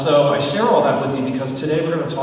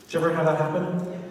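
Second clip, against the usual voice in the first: normal talking voice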